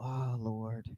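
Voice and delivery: ordinary talk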